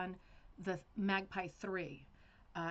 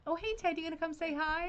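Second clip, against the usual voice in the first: high, loud, bright hello to her son; delighted, high-pitched greeting; excited greeting; 9 semitones higher, 5 dB louder than usual. Real, not acted. Greeting son entering the room.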